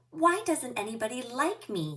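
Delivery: plaintive question. Acted. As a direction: ask sadly why nobody likes him. Lonely fish asks starfish.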